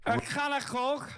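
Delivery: high mock Scouse accent; playful Scouse accent imitation. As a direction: toss it off in a jokey Scouse voice.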